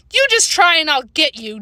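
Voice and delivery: a touch of a yelled dare; yelled threat. A narrator's reading. Jane threatens Sandra while throwing models, mild yell. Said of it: "Jane yelled".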